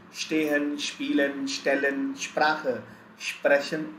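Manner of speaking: "stehen, spielen, stellen, Sprache, sprechen" with sh onsets; German "Sprache" as SHPRAH-khe, listed evenly. Shown as a demonstration. Teacher recaps the consonant rule, each word starting with sh. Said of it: "we will pronounce it as".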